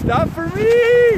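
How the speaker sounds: long, high shout to cars. Real, not acted. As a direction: call out loud and drawn out. Hitchhiker shouting at passing cars.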